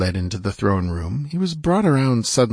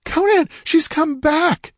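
very high, drawn-out scream of alarm; terrified shriek; 3 dB louder, slower than usual. A narrator's reading. Woman screams to Conan from the oracle chamber, terrified. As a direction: shriek the warning, high and slow. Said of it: "The shriek ended in a gurgle".

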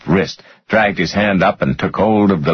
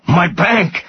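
high, blank echo of a question; repeated blankly; 11 semitones higher than usual. A narrator's reading. Rudder asked where his bank is, blankly repeating. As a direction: echo it high, not understanding. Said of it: "Rudder repeated blankly".

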